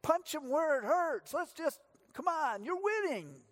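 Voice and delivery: high, excited ringside cheering; hammed-up ringside cheer for Jesus; mock cheering fan. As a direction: egg Jesus on like a boxing fan. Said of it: "we often say".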